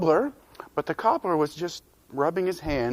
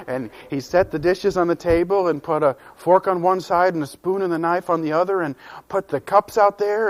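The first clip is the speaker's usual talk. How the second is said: ordinary delivery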